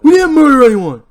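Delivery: loud furious shout; yelling. Acted. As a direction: yell the denial. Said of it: "he whispers that part".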